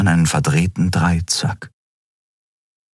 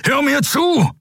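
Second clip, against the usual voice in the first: much higher, shouted plea; desperate shout. A narrator's reading. Kero holding Kai and shouting at him to listen. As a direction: yell to make him listen.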